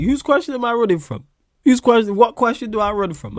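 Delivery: high, loud challenge; indignant rebuttal. Real, not acted. Streamer accused of running from a question, indignant. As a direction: fire it back at the accuser.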